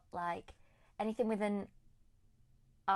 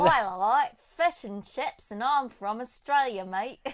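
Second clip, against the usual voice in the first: loud, raised, hammed-up Aussie voice; broad mock-Australian twang; shocking Australian accent; 3 semitones higher, 9 dB louder than usual. Shown as a demonstration. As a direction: overdo a bad Australian accent. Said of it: "That was shocking".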